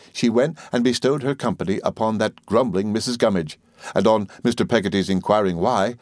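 ordinary delivery

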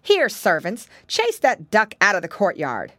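loud commanding order; ordering servants. A narrator's reading. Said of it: "the witch answered".

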